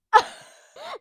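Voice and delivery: very high, loud burst of alarm; startled mock shriek. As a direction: shriek in cartoon panic. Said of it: "and Luffy's like".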